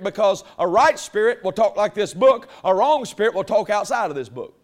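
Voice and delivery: loud, driving preacher cadence; preaching at full force. Acted. Pastor teaching the church how to test spirits, preaching at full force. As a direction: hammer the point home to the congregation.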